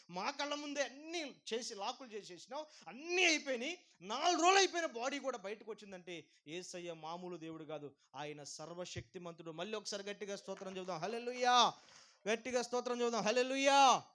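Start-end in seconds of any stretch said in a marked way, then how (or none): 0.1-6.2 rising to an astonished shout, higher and louder; amazed witness. Retold or imitated. Historian retelling Lazarus rising, astonished shout. 9.5-14.0 loud call and response, much louder; rally the crowd to shout praise; preaching at full force. Acted. Pastor rousing the congregation to praise, loud.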